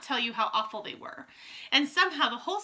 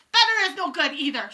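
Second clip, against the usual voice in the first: loud, high witch shriek; Grand High Witch shrieking; 5 semitones higher, 7 dB louder than usual. A narrator's reading. Witch dismissing her coven's promise, shrieking. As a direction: shriek it back with contempt. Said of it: "shrieked the Grand High Witch".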